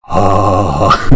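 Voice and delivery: sustained wavering "ah"; wobbly sung "ah"; attempted tune. Shown as a demonstration. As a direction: try a held note as if starting a tune. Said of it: "Attempt a tune".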